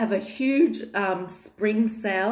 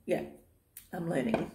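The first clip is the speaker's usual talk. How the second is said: normal talking voice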